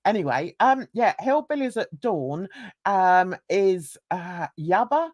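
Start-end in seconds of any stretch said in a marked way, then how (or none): none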